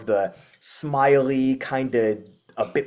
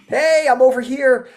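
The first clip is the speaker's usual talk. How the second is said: high, loud shout to a passer-by; excited dog calling out; 9 dB louder than usual. Acted. Dog yelling to somebody across the street, excited high shout. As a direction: holler for attention, pitched way up.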